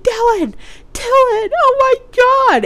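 high, loud frantic cry for help; retelling the panic, shrieking his name; panicked calling out. Retold or imitated. Panicking over flooded toilet, calling boyfriend.

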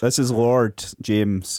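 a hint of a pompous lord, slow; slow, self-important lord. Introducing himself as a lord, slow and lordly. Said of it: "I'm speaking like a lord".

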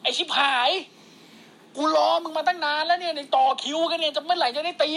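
high, mock-irritated complaint; impatient heckling. Acted impatient heckler waiting to tee off.

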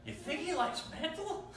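high, loud dog voice; comic high-pitched dog, on the edge of laughing; talking dog. Acted. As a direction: squeak the punchline as the dog. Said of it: "And the dog's like".

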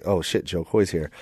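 low, soft imitated murmur; hushed aside. Comics noticing a famous comedian arrive, hushed murmur. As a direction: murmur it low among yourselves.

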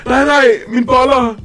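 high, loud, drawn-out wail; hammed-up boyish anguish; panicked retelling. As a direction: lament it slowly in mock panic. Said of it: "jeg gik jo i panik".

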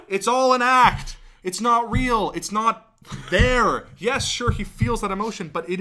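loud, slowed, heated insistence; heated rant. Real, not acted. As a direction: hammer home that it's fake. Ranting about fake soulful singers.

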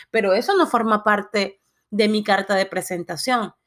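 normal talking voice

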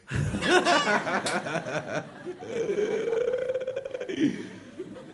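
a long amused laugh; a hearty laugh, breaking the sermon; real laughter. Real, not acted. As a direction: laugh openly at the joke. Laughs at own joke.

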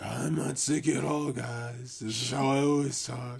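mock stuffed-up voice; stuffy sick voice. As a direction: insist you're fine through a blocked nose. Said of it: "what's with the voice change there".

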